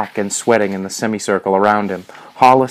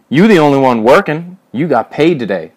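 loud, raised-pitch accusation; accusing; 6 semitones higher, 6 dB louder than usual. A narrator's reading. Major presses Alfred about his pay, accusing. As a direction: throw it at him as an accusation.

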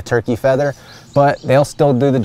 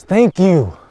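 heartfelt thanks; 7 semitones higher, 3 dB louder than usual. Real, not acted. Thanking the shot grouse.

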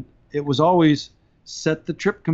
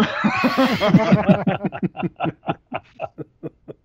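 higher, drawn-out burst of laughter; real extended laughing fit; long laugh; 6 semitones higher than usual. Real, not acted. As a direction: laugh long, gradually tailing off. Laughing at his own story.

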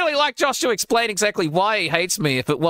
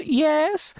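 mock mom answer; 12 semitones higher than usual. Mom answering the prank call.

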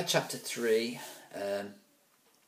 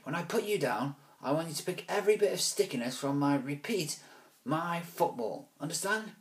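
higher menacing order; bully threat; 7 semitones higher than usual. Acted. Alvis threatening Ruskin as he holds him. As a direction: lay down the law.

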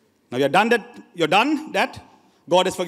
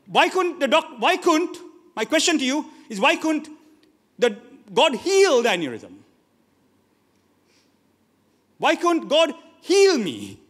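high, raised preaching cry; urgent, pleading pulpit questions; impassioned challenge; 6 semitones higher, 3 dB louder than usual. Real, not acted. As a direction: demand an answer with rising fervour.

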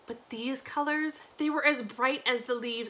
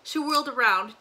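ordinary delivery

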